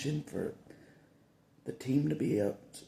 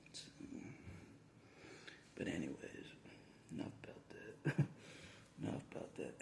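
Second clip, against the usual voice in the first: whispered, trailing off the subject; 7 semitones lower, 14 dB quieter than usual. Real, not acted. Dropping the jail topic in a whisper. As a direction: hush it away.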